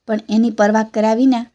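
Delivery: plain reading voice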